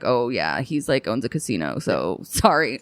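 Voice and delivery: mock shrug-off. Bouncer's brush-off, retold.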